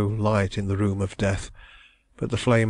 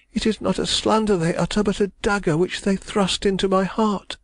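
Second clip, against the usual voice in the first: put-on distressed young woman; 11 semitones higher than usual. A narrator's reading. Young woman protesting her love, high and anguished. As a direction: cry it out as the wronged heroine. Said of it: "she cried".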